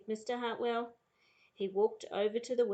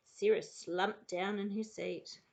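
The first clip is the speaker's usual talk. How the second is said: ordinary delivery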